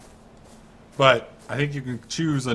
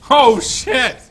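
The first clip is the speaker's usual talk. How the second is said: high, loud sudden outburst; startled yell; 11 semitones higher, 9 dB louder than usual. Real, not acted. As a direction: jump and shout. Sudden danger in game.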